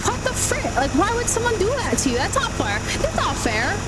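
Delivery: high, indignant Minnesotan; Minnesotan accent. Retold or imitated. Repeating the work slip to a confused coworker, Minnesotan accent. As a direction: protest in a lilting Minnesotan accent. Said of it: "doing a Minnesotan accent".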